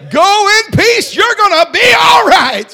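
shouted, high, loud preacher's whoop; full-force preaching. Acted. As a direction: preach it to the rafters, rising in joy.

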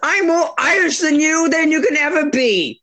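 high-pitched, indignant Irish brogue; mock Irish accent. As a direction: boast in a hammed-up Irish accent. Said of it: "Speak Irish".

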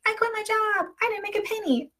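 high, soft replay of her own past words; mock high-pitched lament. Retelling quitting her job, in a high soft mock voice. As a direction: she lifts her voice high to mimic her past self.

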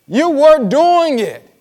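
emphatic preaching. Real, not acted. Preacher driving point home.

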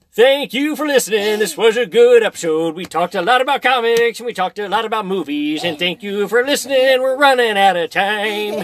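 lightly voiced old man; a hint of a creaky old man. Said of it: "all I can do is the old man voice".

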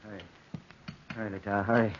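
low mutter, hurrying someone; old man mumbling. Acted. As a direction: mumble it under your breath. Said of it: "the old man muttered".

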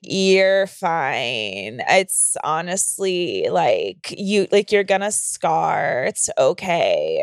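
low, slow, drawn-out vocal fry; lazy, bored low drone, stretching each word; Julia Fox voice. Retold or imitated. Patient retells the doctor's flat reply, low and slow. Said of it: "her voice sounded like fucking Julia Fox".

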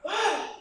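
very high sudden yell imitation; imitated yell. Stranger shouting at the crosswalk, imitated high yell. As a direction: let out a sharp high yelp. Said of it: "se para un tipo y pega".

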